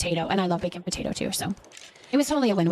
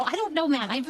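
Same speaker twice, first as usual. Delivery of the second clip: ordinary talk, nothing marked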